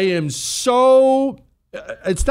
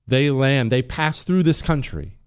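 ordinary delivery

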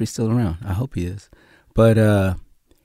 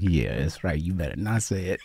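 low, soft mock warning; gloating taunt; 6 semitones lower, 10 dB quieter than usual. Gang member warning the actor off his line, gloating. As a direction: drop down into a smug taunt. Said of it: "He's laughing".

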